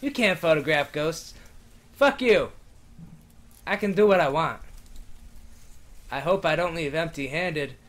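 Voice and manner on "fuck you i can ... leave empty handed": normal talking voice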